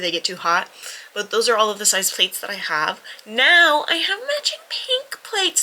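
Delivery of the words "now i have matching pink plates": excited. Real, not acted. Excited about new pink plates.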